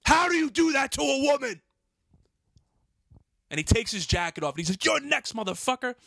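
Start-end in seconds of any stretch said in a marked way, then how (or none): 0.0-1.6 high, loud yell threatening the police; retold enraged screaming. Threatening to call nine one one, retold enraged screaming. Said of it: "I'm screaming". 4.8-5.9 high-pitched imitation of a threat; retold angry threat. Homeless man taking his jacket off, imitated angry threat.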